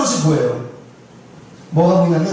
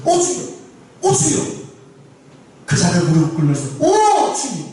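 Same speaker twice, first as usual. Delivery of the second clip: much higher, pleading cry of 오 주여; wife crying out to the Lord; 6 semitones higher than usual. Retold or imitated. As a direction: cry out to the Lord as he raises his fist. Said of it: "이러는 거예요". (남편이 때리려 할 때 높게 외치는 간절한 부르짖음)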